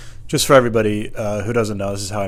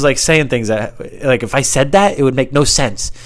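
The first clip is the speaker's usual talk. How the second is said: ordinary talk, nothing marked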